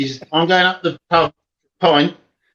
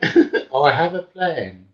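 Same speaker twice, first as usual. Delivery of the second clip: slow, drawn-out comic declaration; Blackadder comedy impression; 2 semitones lower, much slower than usual. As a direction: announce it slowly in a put-on voice. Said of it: "Blackadder School of".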